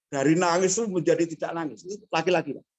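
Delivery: usual speaking voice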